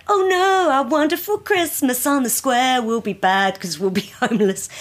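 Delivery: a lightly mocking dismayed villager; mocking the townsfolk's fake dismay. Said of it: "the town folk are like".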